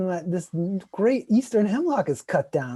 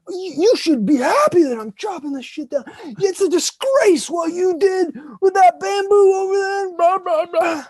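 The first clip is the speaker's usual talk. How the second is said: higher, louder mock rant; ranting neighbor; 13 semitones higher, 10 dB louder than usual. Neighbor berating the speaker over the bamboo, loud, high mock rant. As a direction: scold like an outraged bully. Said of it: "he's like".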